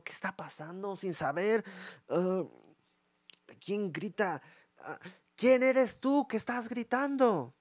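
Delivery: high, soft, drawn-out mock groggy voice; groggy confusion. Abner just woken asking who is yelling, soft and groggy. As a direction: sound just woken and lost.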